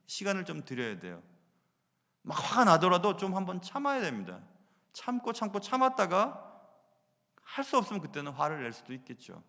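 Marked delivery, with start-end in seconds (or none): none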